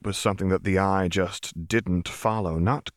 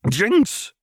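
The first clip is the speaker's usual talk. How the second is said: high, loud startled cry; startled outburst with a full mouth; gasped out; 13 semitones higher, 6 dB louder than usual. A narrator's reading. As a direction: gasp the name out, voice leaping up. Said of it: "Chuck gasped out".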